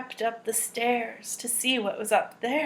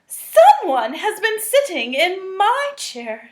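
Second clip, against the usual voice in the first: higher, louder, indignant mama; Mama Bear voice; 6 semitones higher, 7 dB louder than usual. Acted. Reading to children, mama bear finds her chair used, higher. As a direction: lift it up like a startled mother bear.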